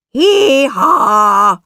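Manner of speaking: loud, high hee-haw; donkey bray. Acted. Boy turned donkey brays.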